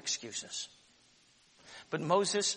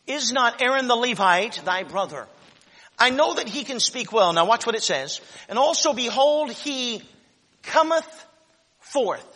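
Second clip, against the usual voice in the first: preached scripture reading; 8 semitones higher, 12 dB louder than usual. Acted. Congregation hears God's reply preached aloud. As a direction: read out loud and high like a sermon.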